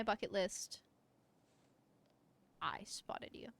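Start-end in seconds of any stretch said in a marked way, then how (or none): 2.6-3.5 whisper. Real, not acted. Aside while playing.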